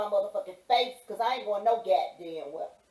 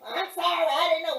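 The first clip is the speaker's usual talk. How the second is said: mock meek apology; 7 semitones higher, 5 dB louder than usual. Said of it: "who the fuck I am".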